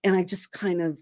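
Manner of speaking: ordinary delivery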